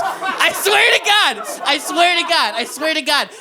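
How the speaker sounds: high-pitched, repeated earnest vow; emphatic insistence. Real, not acted. Comic to a laughing crowd about the lawyers, emphatic insistence. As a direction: swear it high and urgently, as if nobody believes you. Insists the unlikely story is true.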